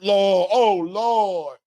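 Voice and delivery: mock cry of awe from the fighter; imitating fighter's yell. Host imitates Nestor Bravo's exclamation, mild mock yell. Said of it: "Nestor Bravo said".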